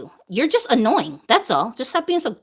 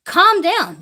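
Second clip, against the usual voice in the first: loud calm down; 6 semitones higher, 4 dB louder than usual. Real, not acted. Scolding the loud ones.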